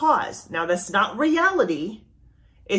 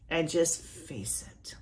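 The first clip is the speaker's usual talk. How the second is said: slow, much softer whispered resolve; 3 semitones lower, 8 dB quieter, slower than usual. Real, not acted. Preacher gently urging listeners to face it, whispered. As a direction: hush it down as you resolve.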